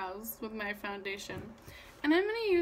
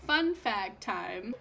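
usual speaking voice

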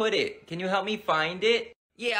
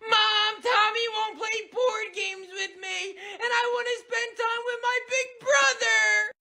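put-on whiny tattling kid; slower than usual. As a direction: complain to mom about big brother.

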